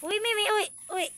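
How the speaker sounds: high, loud call to the goat. Real, not acted. Owner calling a goat named Mimi, loud high call. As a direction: call her over from across the yard.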